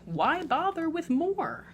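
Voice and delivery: plain reading voice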